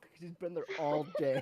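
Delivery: ordinary talk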